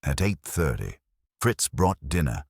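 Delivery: ordinary delivery, nothing marked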